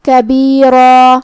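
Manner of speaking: كَبِيرًا slow, the را and the long alif full and heavy; كَبِيرًا with a full, heavy را and stretched alif. Shown as a demonstration. Teacher demonstrates tafkhim on ra, slow and heavy. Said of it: "را کو اور مد الف کو دونوں حرف کو بھاری آواز میں".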